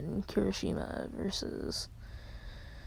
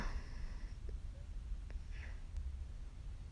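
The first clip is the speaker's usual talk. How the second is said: long, quiet exhale; soft sigh of giving in; resigned sigh; 15 dB quieter than usual. Acted. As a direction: let out a soft, drawn-out sigh. Resigned sigh after agreeing.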